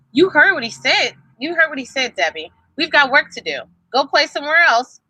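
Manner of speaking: a hint of a bossy brother; bossy big brother. A narrator's reading. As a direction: brush the little sister off. Brother shoos little sister.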